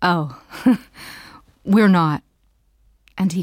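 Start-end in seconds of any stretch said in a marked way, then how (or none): 0.0-2.2 slower laughing correction, lightly voiced man; male passenger. A narrator's reading. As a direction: draw it out with an amused laugh. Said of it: "just as he says".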